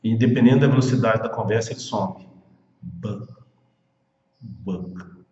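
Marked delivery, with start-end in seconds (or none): 2.8-5.2 Book with the K only faintly heard; Book, soft K heard far off; K barely there. Shown as a demonstration. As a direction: let the K of Book almost vanish. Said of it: "ele aparece assim bem longe".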